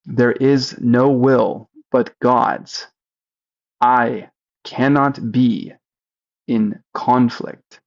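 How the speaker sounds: very slow, each word drawn out; slow it right down, word by word. Shown as a demonstration. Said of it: "Slower this time".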